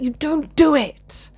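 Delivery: forceful, high and slow telling-off; emphatic admonishment. Retold or imitated. Speaker confronting someone who tears people down, emphatic admonishment.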